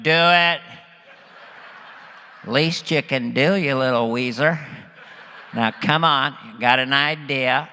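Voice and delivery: pushy presidential impression; George Bush. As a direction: order him around as a folksy president, punched loud at first. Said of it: "He's like".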